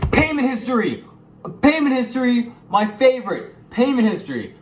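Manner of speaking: hyped shout. Real, not acted. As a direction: announce it like a game show host. Hyped topic announcement.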